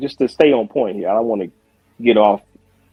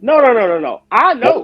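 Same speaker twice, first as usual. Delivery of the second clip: emphatic denial; 8 semitones higher than usual. Real, not acted. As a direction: cut him off, insisting hard.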